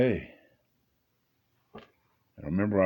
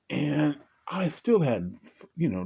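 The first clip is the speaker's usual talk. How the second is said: ordinary delivery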